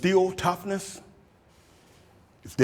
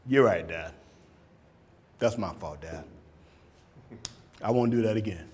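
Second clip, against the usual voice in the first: lower, softer contrite reply; a hint of a sorry kid; 6 semitones lower, 5 dB quieter than usual. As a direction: apologise to dad meekly. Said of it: "And they'll say".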